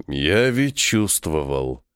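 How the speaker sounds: low, very slow resigned repeat. A narrator's reading. Vangur repeats that he had sensed it, resigned. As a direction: draw it out low and defeated. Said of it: "обречённо произнеся".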